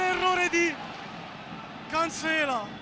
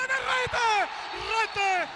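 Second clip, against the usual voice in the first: breathless italian goal commentary; 5 semitones higher, slower than usual. Real, not acted. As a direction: burst out on the goal. Goal scored after build-up.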